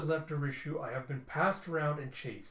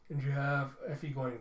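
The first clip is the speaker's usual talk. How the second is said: normal talking voice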